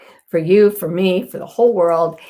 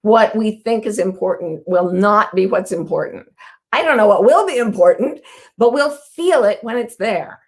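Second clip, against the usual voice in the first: normal talking voice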